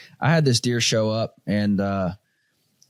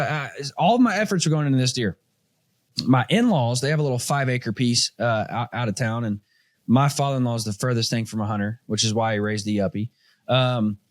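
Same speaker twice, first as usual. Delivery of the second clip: normal talking voice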